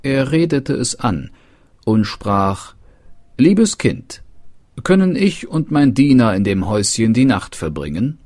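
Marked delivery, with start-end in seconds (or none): 3.4-8.2 put-on higher and louder, an eager young prince; prince's polite request. Prince asks the girl for a night's shelter, eager and raised. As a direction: call out kindly to the girl, raised voice.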